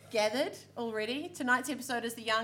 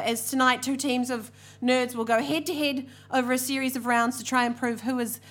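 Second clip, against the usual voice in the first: ordinary delivery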